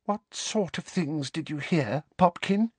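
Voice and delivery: breathed, high and anxious; breathy. A narrator's reading. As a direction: breathe it out tearfully. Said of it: "breathed Aunt Petunia".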